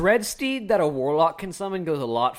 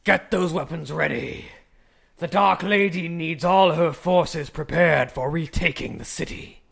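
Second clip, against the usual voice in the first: raspy, half-shouted orders; raspy shouted commands; 3 semitones higher, 5 dB louder, slower than usual. Acted. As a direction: rasp out orders like an undead sergeant. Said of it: "very raspy shouted commands".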